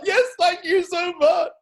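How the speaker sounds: high, loud, slow, laughing it out; laughing thanks. Retold or imitated. Thanking the instructor, laughing with joy. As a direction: gush with giddy relief.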